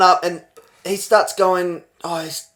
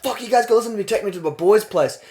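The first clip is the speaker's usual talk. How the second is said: high-pitched imitation of badgering; pushy insistence; 8 semitones higher than usual. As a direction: imitate a crackhead demanding to be heard. Crackhead insists on his friend's place.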